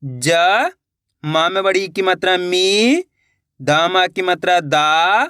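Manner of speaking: name each vowel sign, then stretch "मी" and "दा"; teacher spelling "ज… मी… दा" with pauses; letter-by-letter pronunciation. Shown as a demonstration. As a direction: sound out "ज", "मी", "दा", each held long. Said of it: "जैसे कि मैं पढ़ रहा हूँ".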